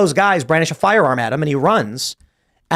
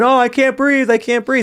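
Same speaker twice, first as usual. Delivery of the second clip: high-pitched mock cry of distress; retold panicked yelp, pitched up; imitated screaming; 9 semitones higher than usual. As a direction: imitate a pinned man crying out. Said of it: "He's screaming".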